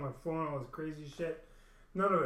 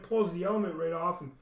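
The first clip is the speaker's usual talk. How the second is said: normal talking voice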